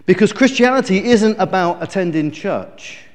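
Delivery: ordinary delivery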